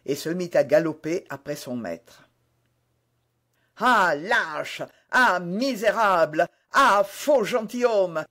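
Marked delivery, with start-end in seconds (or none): 3.8-8.2 raised, outraged shouting; indignant cry, building in pitch and force; crying out insults. A narrator's reading. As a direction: hurl each insult louder than the last. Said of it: "cria D'Artagnan".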